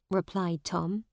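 ordinary delivery

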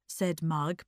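usual speaking voice

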